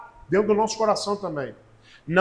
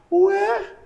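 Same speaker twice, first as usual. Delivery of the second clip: sonsa fingida; 10 semitones higher than usual. Retold or imitated. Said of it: "Sabe aquela pessoa que é sonsa?".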